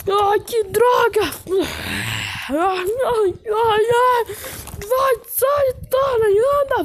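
high, crying panic at the door; frantic pleading to get out; terrified horror victim. Acted. Trapped kids begging door open.

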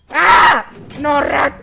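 high, loud yelp then pleading; frightened scream. Real, not acted. Player scared by Rat, frightened yelp. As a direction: yelp at the monster in real fear.

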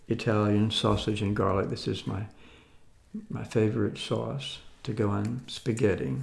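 ordinary talk

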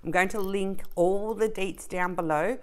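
normal talking voice

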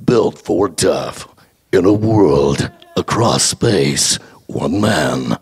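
very low, slow movie trailer announcer; deep truck ad voice. Retold or imitated. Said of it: "that really low, uh, sort of truck ad".

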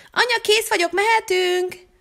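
put-on high, bright kid calling out to mom; a kid impatient to leave, calling up high; high excited call to mom, ready to go; eager child call.

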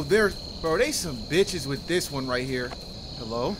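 usual speaking voice

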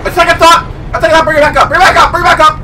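high, frantic backpedalling; comic panic. Real, not acted. Player wants the lift brought back up, high comic panic.

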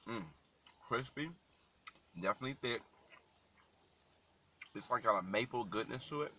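eating and tasting aloud; talking while chewing. Real, not acted. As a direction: mouth partly full of food. Seen 1: Bites bacon at -1; lips closed, chewing between phrases throughout.

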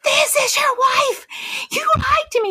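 high-pitched, indignant shouting; acted angry scream. Wife tells baffled husband who is calling, acted angry scream. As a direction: shriek the accusation down the phone.

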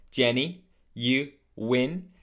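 GENUINE split as "Jenny", "you", "win"; broken into pieces. Shown as a demonstration. Teacher breaking it down, Jenny you win separately. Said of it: "break it down to three pieces".

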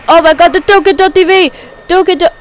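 high, loud startled outburst; alarmed play-by-play. Real, not acted. Commentator seeing Josh T V attacked, alarmed shout. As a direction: blurt it out in shock. Reacting to a choke live.

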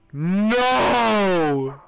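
usual speaking voice

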